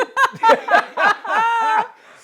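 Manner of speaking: high, loud burst of laughter; real laugh; big laugh. Real, not acted. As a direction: crack up hard. Laughs at his punchline.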